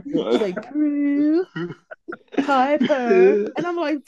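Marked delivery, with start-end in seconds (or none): 0.7-1.4 high-pitched, mimicked "Prue"; odd name pronunciation. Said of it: "neither one of them say the". 2.4-3.5 high, loud "Piper" ending in uh; odd name pronunciation. Retold or imitated. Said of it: "Why are you saying Piper?".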